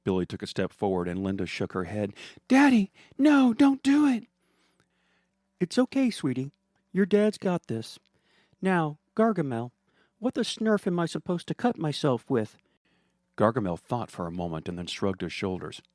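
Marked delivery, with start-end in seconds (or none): 2.5-4.2 put-on little girl's high, loud plea; much higher and louder, begging daddy to stop; high child begging. Linda begging her daddy not to do it, in a high little-girl voice. Daughter begs her father. 5.6-12.6 pitched-up reassuring dad, then a snarky question; higher, comfort the child, then challenge the villain; higher father voice. Acted. Billy comforting his daughter, then mocking Gargamel, in a higher voice.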